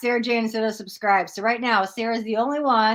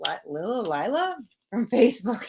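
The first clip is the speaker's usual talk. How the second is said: normal talking voice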